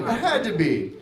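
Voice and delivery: normal talking voice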